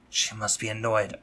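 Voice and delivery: usual speaking voice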